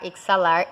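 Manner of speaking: "exalar" said as ek-sa-LAR; "exalar" with a hard KS for X; wrong KS pronunciation. Shown as a demonstration. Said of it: "Você não fala".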